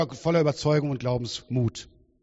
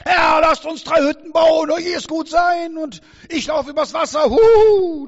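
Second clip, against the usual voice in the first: hammed-up brash voice, much higher and louder; cheeky Peter; 12 semitones higher, 10 dB louder than usual. Preacher mocks bold Peter's cheeky boasting, loud and high. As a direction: shout it like a cocky show-off. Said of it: "Ein vorlautes Stück. Freche Sprüche".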